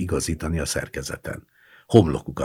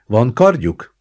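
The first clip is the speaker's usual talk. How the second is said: raised, louder stern demand; stern question; 8 semitones higher, 7 dB louder, slower than usual. A narrator's reading. Kriszta questioning the gendarmes, sternly. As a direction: ask it strictly. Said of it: "kérdezte szigorúan Kriszta".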